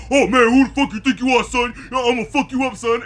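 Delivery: put-on tough guy voice. Podcaster mocking Marlon's tough-guy line, loud hammed-up. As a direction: bark it like a street tough picking a fight. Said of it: "he has this deeper voice than he ever had".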